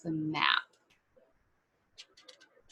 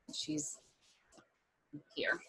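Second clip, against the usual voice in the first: usual speaking voice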